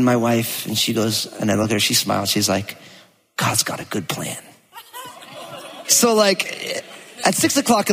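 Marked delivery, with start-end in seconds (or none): 3.4-4.4 whispered, much softer. Retold or imitated. Wife smiling at her grumpy husband, whispering. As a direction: breathe it out gently with a smile.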